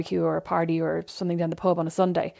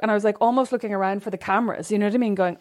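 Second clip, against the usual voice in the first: normal talking voice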